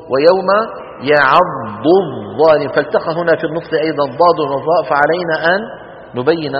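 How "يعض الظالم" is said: يعض الظالم said slowly with the ض separated from the ظ; slow recitation demo. Shown as a demonstration. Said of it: "والمثال الثاني".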